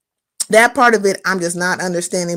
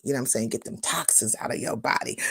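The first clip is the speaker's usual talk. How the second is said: normal talking voice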